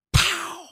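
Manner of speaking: high, loud sudden pow; mock explosion pow. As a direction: burst out the spark's bang. Spark explodes, imitated.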